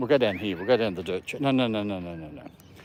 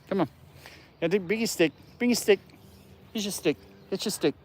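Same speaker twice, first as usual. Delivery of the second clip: coaxing dog talk; 11 semitones higher than usual. Real, not acted.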